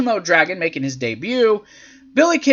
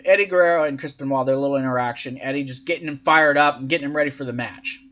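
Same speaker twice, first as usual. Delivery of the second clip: ordinary talk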